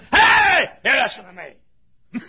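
loud sharp shout, then slow stern command; furious father yelling at a child; angry shout. Retold or imitated. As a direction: bark hey, then lay down the law slowly.

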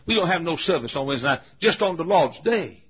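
imitated Southern deacon. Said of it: "This is the way all of us Southerners talk".

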